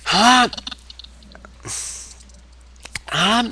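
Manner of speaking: short, high-pitched huh; mock questioning huh. Repeating the acted reaction.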